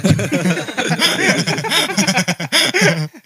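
high loud burst of laughter; big laugh. Real, not acted. Host cracks up at the Lampung insult with loud laughter.

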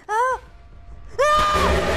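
high, very loud shriek of fright; real piercing scream of shock; startled scream. Real, not acted. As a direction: scream at a sudden jump scare. Jump scare in the game.

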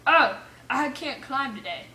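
normal talking voice

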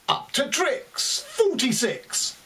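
high slow bingo call; bingo caller. Pretend. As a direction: sing out the number to the hall. Bingo calls in poem.

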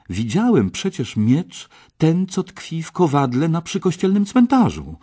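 high, loud burst of remembering; excited realisation. A narrator's reading. Artur remembers the sword in the anvil, excited realisation. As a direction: seize on the sudden idea.

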